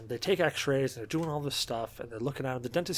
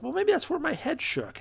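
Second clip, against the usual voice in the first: hopeful excuse; 12 semitones higher than usual. Retold or imitated.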